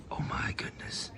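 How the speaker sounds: hushed documentary whisper; breathy wildlife narrator aside. Pretend. Whispered nature-show aside.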